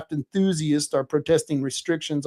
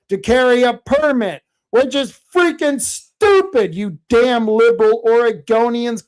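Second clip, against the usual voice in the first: loud, higher, furious outburst at liberals; angry rant; 6 semitones higher, 6 dB louder than usual. Real, not acted. Podcaster ranting at Oregon permit fees, angry and loud. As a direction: spit out the contempt, louder and higher.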